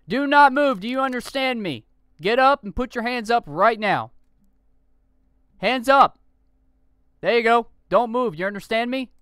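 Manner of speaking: loud, high police orders; shouted commands. Acted. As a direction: command the suspect hard. Officer commanding suspect.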